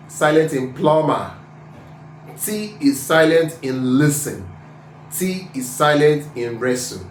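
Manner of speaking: ordinary talk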